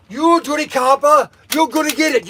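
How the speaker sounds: high, loud mock tough-guy threat; hammed-up gangster snarl. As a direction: play an old movie gangster threatening a cop. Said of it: "We also have the gangster".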